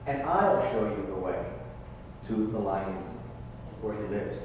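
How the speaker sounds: low, soft sly fox voice; cunning fox. Acted. Fox luring the chicks to his den, low and sly. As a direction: lure them in low and soft.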